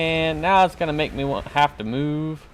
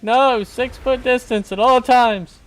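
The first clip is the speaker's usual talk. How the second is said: high, loud, drawn-out mock protest; mock refusing teammate; 10 semitones higher, 5 dB louder, slower than usual. Gamer mimicking the teammate who runs away, mock protest. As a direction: imitate someone loudly insisting on distance. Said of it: "he's over there like".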